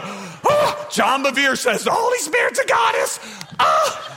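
high, loud mock outrage with a gasp; mock scandalized churchgoer. Congregant leaving tonight appalled at the teaching, mock scandalized. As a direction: imitate a shocked listener at full volume. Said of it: "Don't you dare walk out of here tonight and go".

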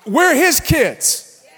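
high, loud shouted sermon climb; full-force preaching. Real, not acted. Pastor to congregation, loud shouted preaching. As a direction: preach it hard to raise the room.

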